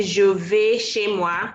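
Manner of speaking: Je vais chez moi said slowly, each word clear; lent, en français; slow French model. Shown as a demonstration. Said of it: "You can say".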